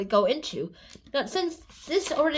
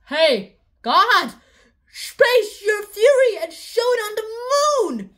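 louder, higher, slow proclamation; mock grand invocation; 7 semitones higher, 6 dB louder, slower than usual. Columbus calling God to darken the moon, hammed-up proclamation. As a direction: call on God theatrically. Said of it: "Columbus calls on God".

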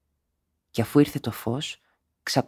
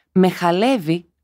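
crying; 5 semitones higher, 5 dB louder, slower than usual. A narrator's reading. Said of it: "κλαίοντας".

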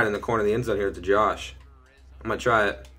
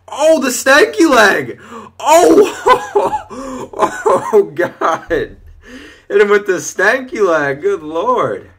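high, loud whooping with laughter, words stretched; hysterical excitement; 10 semitones higher, 10 dB louder, much slower than usual. Real, not acted. Gamer hyping Desmond's stanky leg move, hysterical excitement. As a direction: crow in delight, breaking into laughter.

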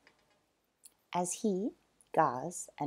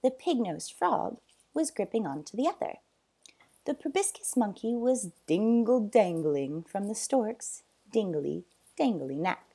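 plain reading voice

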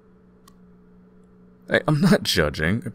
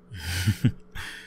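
soft laugh; 4 dB quieter than usual. Acted. Glad the roommate feels warmer, quiet chuckle. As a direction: chuckle gently, pleased.

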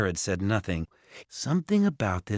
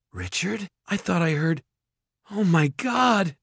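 high voice, dazed then horrified; high-pitched dawning panic; alarmed mother; 9 semitones higher than usual. A narrator's reading. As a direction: start confused and high, end in a stretched gasp of horror. Mother wakes and sees the ogre.

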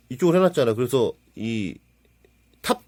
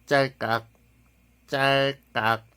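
mouth camera click; much slower than usual. Retold or imitated. Takes a photo.

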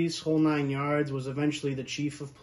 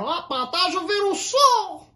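imitated Terceira accent; 7 dB louder than usual. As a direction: mimic the uncle's exaggerated Terceira accent. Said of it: "it's just the accent".

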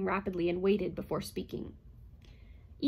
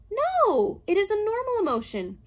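much higher, louder robot voice; robot reassuring; 13 semitones higher, 9 dB louder than usual. A narrator's reading. Herbie reassuring Calvin in a high, loud robot voice. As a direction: soothe her in a high machine voice.

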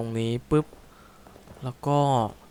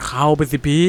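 loud, high frantic urging; panicked loud push; urgent yell; 4 semitones higher, 8 dB louder than usual. Real, not acted. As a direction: shout at him to get inside. Urging the character to hide.

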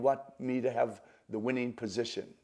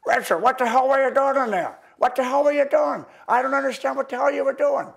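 put-on loud, high, astonished old professor shouting; Vernon voice; 14 semitones higher, 13 dB louder than usual. Professor Vernon after the show demanding what Richard did, loud high astonished shout. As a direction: blurt it out like the old master who can't believe his eyes. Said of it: "After the show, he goes".

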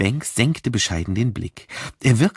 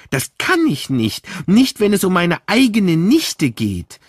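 a louder retold protest; raised voice, retold; 8 semitones higher, 5 dB louder than usual. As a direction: quote his loud refusal. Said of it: "ziemlich laut geworden".

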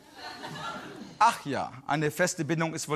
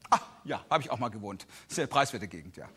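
condescending; 9 semitones lower, 6 dB quieter than usual. Retold or imitated. Ricarda's condescending remark.

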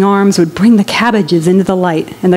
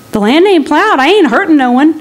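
higher, defensive farmer voice; a poor man begging to be left alone; pleading squatter; 8 semitones higher than usual. Acted.

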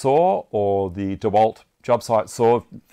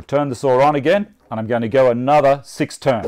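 shouting over table saw; slower than usual. Real, not acted. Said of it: "so I stop talking too loud".

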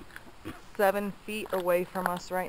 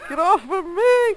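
high, loud whiny squeal; 10 semitones higher, 8 dB louder than usual. Real, not acted. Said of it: "That was me whining like a baby".

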